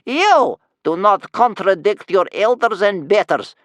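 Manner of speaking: loud, high, slow grandmotherly scolding; indignant old woman rebuking a child; stern cry. A narrator's reading. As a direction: cry it out sternly, stretching the words. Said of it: "cried his grandmother sternly".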